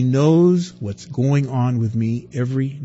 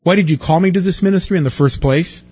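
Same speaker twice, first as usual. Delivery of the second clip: higher and louder, agitated whining; Moses complaining; 7 semitones higher, 5 dB louder, faster than usual. Acted. Moses pouring out complaints to God, higher and louder. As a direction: rail at God for the mess.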